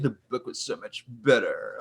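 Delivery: low, soft, lofty sneer; a quiet, haughty book snob; snooty book snob. Shown as a demonstration. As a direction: say it with a superior air. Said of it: "you might as well say it like that".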